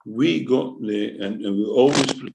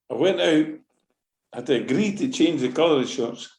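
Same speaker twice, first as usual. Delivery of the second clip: ordinary talk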